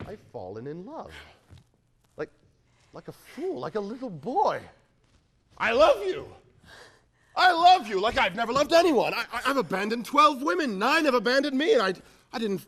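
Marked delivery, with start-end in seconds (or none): 5.6-12.6 loud, high, impassioned; passionate declaration. Acted. Suitor on his knees to the woman, loud passionate confession. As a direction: pour it all out breathlessly.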